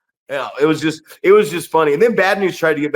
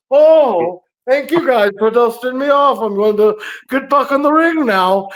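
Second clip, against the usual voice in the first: a high, somewhat louder put-on voice for a wrestling heel; mock bad guy voice; 8 semitones higher, 3 dB louder than usual. Bad guy thanking the crowd for dusting him off, in a high, hammed-up put-on voice. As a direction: ham up the bad guy's fake gratitude. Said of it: "and he's like".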